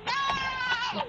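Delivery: a sudden high shriek of no; terrified scream. Acted. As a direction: scream it out as he grabs her. Attacked in the park.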